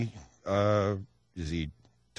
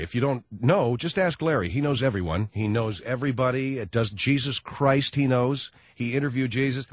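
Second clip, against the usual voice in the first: normal talking voice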